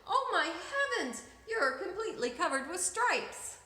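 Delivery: high, alarmed mother's cry; shocked mother. A narrator's reading. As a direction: gasp it out like a shocked mom. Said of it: "she screamed too".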